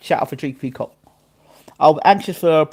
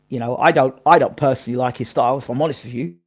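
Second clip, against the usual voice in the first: ordinary delivery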